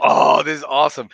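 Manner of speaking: acting out happy amazement; overjoyed. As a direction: gush like you can't believe your luck. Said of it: "I was, like, so happy".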